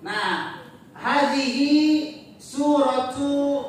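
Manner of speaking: ordinary talk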